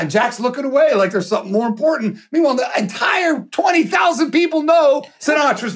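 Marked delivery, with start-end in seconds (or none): 2.3-5.7 high, incredulous crescendo; excited storytelling. Real, not acted. Storyteller stressing that the whole stadium knows, high excited disbelief. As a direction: build the tension, pitch far up.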